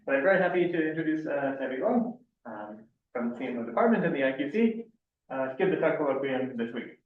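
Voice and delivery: muffled behind a mask; through a face mask. Real, not acted. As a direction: speak through a cloth mask. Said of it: "hear me with my mask".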